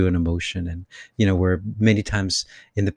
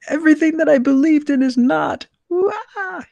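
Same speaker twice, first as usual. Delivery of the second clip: very high, loud squealing meltdown; mock angry outburst; 6 dB louder than usual. Acting out anger at lost beliefs, exaggerated shrieking outrage. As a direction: wail it like a hammed-up tantrum. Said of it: "the first reaction is anger".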